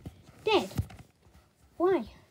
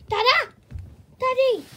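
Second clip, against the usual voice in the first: excited; 8 semitones higher, 6 dB louder than usual. Acted. Child greets returning dad.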